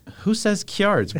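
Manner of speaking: "Kjards" drawn out slowly as "kyards"; incredulous dialect pronunciation. Retold or imitated. Reader baffled by the book's accent, "Kjards" stretched as "kyards". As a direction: question "Kjards" in disbelief, y-glide after the K. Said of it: "And I'm like".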